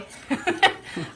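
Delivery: short breathy laugh. Real, not acted. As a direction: laugh it off lightly. Laughs at her belly against the counter.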